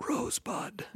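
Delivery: high, breathless whisper of Rosebud; whispered dying word. Retold or imitated. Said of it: "as we mentioned".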